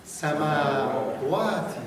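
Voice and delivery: سماواتی stretched very slowly, each vowel held; slow Arabic recitation. Shown as a demonstration. Teacher repeats after a student's mistake, very slow recitation. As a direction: model سماواتی again, very slowly. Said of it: "دوباره".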